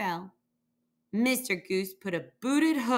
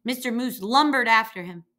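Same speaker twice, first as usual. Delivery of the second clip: usual speaking voice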